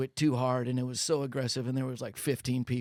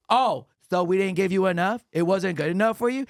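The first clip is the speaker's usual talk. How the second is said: louder indignant question; mock offended customer; 5 semitones higher, 6 dB louder than usual. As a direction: sound insulted and defensive. Ladies offended by complaint.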